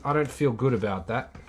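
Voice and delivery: ordinary delivery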